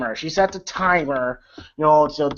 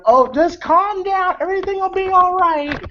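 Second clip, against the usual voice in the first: dismissive cop; 12 semitones higher than usual. Retold or imitated. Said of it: "the cop is like".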